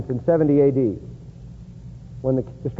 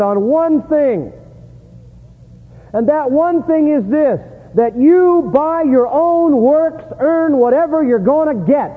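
raised, higher-pitched sermon delivery; impassioned preaching; 7 semitones higher, 3 dB louder than usual. Real, not acted. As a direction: drive the point home from the pulpit. Preaches the point forcefully.

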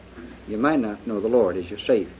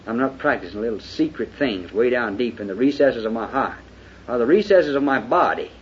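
ordinary delivery

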